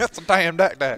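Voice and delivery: jokey put-on accent, not Australian; playful non-Australian accent copy. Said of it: "doing that in a not an Australian accent".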